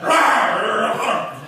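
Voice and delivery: loud, blunt growling "rah"; Carla. Retold or imitated. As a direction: tough barmaid grumble. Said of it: "Like Carla just said".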